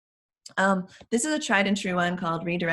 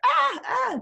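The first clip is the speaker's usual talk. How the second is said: mock child scream, much higher and louder; toddler scream; 5 dB louder than usual. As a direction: voice a screaming toddler being carried out. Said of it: "still screaming".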